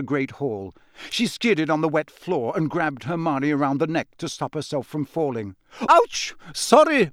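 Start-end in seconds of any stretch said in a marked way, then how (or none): 5.9-7.1 high, loud cry and apology; yelp, then blurt an apology; flustered, pitched-up exclamation; startled yelp. Acted. Skids and grabs girl's neck.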